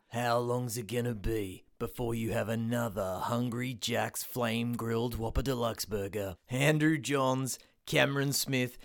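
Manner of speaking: low, punchy TV promo voice; ad announcer. Acted. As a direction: sell it like a sports promo. TV ad announcer read.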